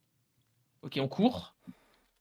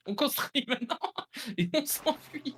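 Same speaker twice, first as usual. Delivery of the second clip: laughing; 8 semitones higher than usual. Real, not acted. Laughing while building an escape.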